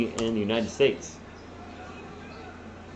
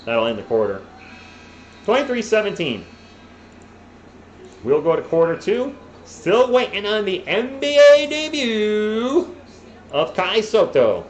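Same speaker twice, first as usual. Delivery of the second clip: play-by-play; 8 semitones higher, 6 dB louder, slower than usual. Acted. Mock play-by-play of a game.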